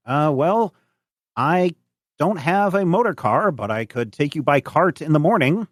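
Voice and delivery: high, hesitant farmer voice; old farmer, obliging. Acted. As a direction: offer help in a high character voice. Game master voices old farmer.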